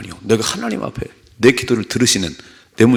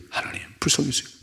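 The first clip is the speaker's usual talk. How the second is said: fast, whispered, pleading prayer; desperate whisper; 5 semitones higher, 8 dB quieter, faster than usual. Retold or imitated. Preacher showing a sincere prayer, whispered fast. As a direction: rush it out under your breath. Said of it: "와가지고 끝까지".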